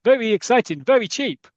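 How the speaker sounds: loud, high mock Spanish tout; Spanish accent. Van driver touting a mountain trip, mock Spanish accent. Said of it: "a guy in there goes".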